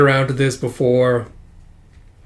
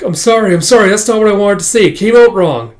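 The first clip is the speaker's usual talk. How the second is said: loud, higher scrambling apology; flustered apology; 6 semitones higher, 7 dB louder than usual. Acted. Guest after a botched speech, flustered apology. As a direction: backpedal in a raised, high voice.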